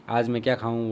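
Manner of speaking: ordinary delivery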